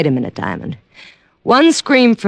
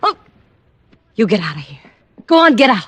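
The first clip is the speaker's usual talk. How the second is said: angry; 4 semitones higher, faster than usual. Acted. Singer throws Diamond out.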